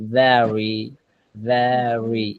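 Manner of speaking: very said slowly and low, each sound drawn out; slow pronunciation. Shown as a demonstration. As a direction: spell out very slowly like the app. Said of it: "এভাবে আস্তে আস্তে শোনাবে". (very ধীরে ধীরে উচ্চারণ)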